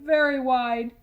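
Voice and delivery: ordinary delivery, nothing marked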